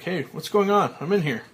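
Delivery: loud alarmed call. Acted. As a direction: shout to be heard. Trapped in coffin, calling out.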